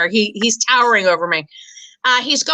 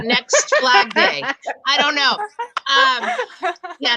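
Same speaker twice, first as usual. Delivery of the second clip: ordinary delivery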